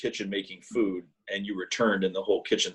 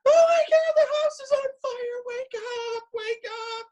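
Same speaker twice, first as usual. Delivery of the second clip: far higher, louder frantic screaming; panicked scream; 4 dB louder than usual. Retold or imitated. Mom pounding on son's door at dawn, panicked shriek. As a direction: shriek it like the house is burning. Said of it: "screaming bloody murder".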